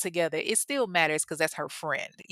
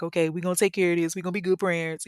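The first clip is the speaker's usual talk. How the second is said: ordinary talk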